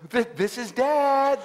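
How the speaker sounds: high, slow, halting announcement; acting out a father calling to his baby; dad greeting screaming newborn. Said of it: "I go, I go".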